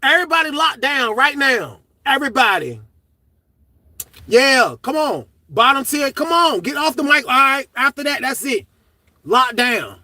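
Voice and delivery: loud barked orders to the pod; officer yelling lockdown. Retold or imitated. Guard ordering inmates to lock down, loud shout. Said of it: "She said".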